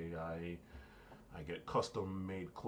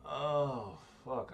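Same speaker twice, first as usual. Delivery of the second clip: amused exclamation; 8 semitones higher, 6 dB louder, much slower than usual. Real, not acted. Laughing at own accent attempt.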